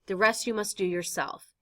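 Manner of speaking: ordinary talk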